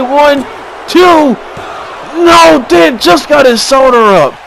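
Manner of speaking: breathless hype call; excited count. Real, not acted. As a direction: shout the kickout. Near fall count.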